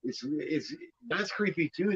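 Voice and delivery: ordinary delivery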